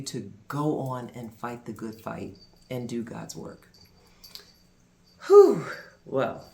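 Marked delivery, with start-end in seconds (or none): 5.3-5.6 overwhelmed exhale, much higher and louder; loud high whew. Real, not acted. Recalling the miracle for viewers, a loud amazed whew. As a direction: blow it out, still amazed.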